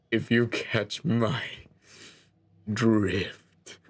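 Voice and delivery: slow, with a long pause before drift; knowing pun. Pretend. Narrator punning to the player on the beach, slow knowing delivery. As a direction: draw it out, then drop the pun.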